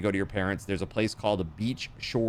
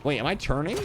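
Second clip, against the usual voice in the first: ordinary talk, nothing marked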